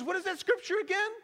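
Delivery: high, fast, put-on hairstylist voice; eager mock question. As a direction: imitate her suddenly keen asking. Acts hairstylist's sudden eager question.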